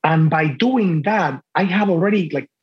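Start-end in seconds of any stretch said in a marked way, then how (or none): none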